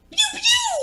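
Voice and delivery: high, loud pew pew laser sound; toy laser noise. Pretend. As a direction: fire off cartoon lasers. Mimicking lasers while showing earrings.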